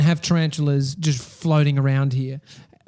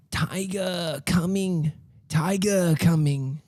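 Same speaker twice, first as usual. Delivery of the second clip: slow, hushed toddler imitation; worried little kid; 5 dB quieter, much slower than usual. Dad imitating his son warning of a tiger, slow worried child voice. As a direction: draw it out softly like an anxious small child. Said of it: "he looks really worried".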